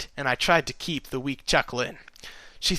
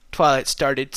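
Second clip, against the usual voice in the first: plain reading voice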